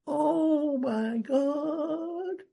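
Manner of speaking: dawning realization. Retold or imitated. Sudden realization about the cake.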